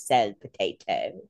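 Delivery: imitating his accented "potato", slow and low; amused accent mimicry, drawn out and dropped low; Klaus's accent. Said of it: "says potato with his accent".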